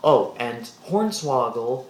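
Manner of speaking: ordinary talk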